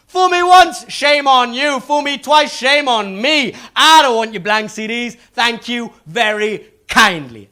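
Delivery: high, loud, slow polite brush-off; mock polite refusal. Comedian acting out turning down the salesman with mock courtesy. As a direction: lecture him with mock courtesy. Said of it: "I'm like".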